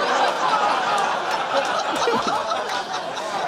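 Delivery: high breathless wheeze; airy silent laugh; wheezing laugh. Real, not acted. Comic reacting to dad's disappointment, wheezing.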